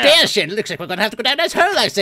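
high, loud, fast posh English panic; posh toff yelping as he falls; panicked posh English gent. Pretend. Posh character panics in game.

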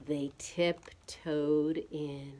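slow, soft suspenseful storytelling; low, drawn-out hush; hushed narration. A narrator's reading. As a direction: tiptoe through the words, softly and slowly. Narrating sneaking in quietly.

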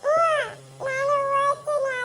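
slow, sobbing cry. Acted. Girl answering her grandfather, crying aloud. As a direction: wail the words while crying.

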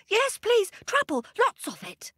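high, eager monkey. A narrator's reading. Monkey eagerly answering Papa God in a high voice. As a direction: plead for lots of it.

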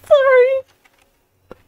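high squeaky oh sorry. Real, not acted. Streamer apologising to viewers for skipping, high squeak.

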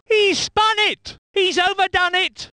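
high, urgent incident call; alarmed excitement. Acted. Commentator reacting to a driver's spin, high urgent call. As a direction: react at once to the mistake, pitched high.

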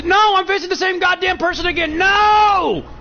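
yelling high in real frustration; furious shout at the game; frustrated shout. Real, not acted. Frustrated gamer loses same opponent.

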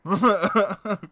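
loud, high laughing burst; burst of laughter. Real, not acted. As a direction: crack up at the joke. Laughing at the joke.

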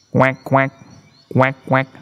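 short, evenly paired quacks; duck quack. A narrator's reading. Duck replies with animal noise.

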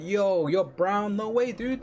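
high, drawn-out disbelief; stunned listener, played up; mock astonishment. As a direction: imitate an amazed viewer, voice lifted high. Acting out stunned viewers seeing the webcam.

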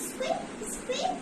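very high little squeaks; mouse squeak. Shown as a demonstration. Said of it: "Mouse says".

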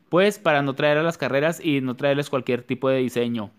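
ordinary talk

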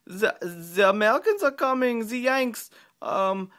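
high, loud hammed-up German accent; over-the-top war-movie German accent, shouted high. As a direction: play a panicked German soldier in a mock German accent. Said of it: "sorry for the German accent".

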